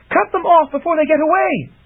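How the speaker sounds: high, eager order to cut them off; urgent command. A narrator's reading. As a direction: urge Trent on. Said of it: "she cried again".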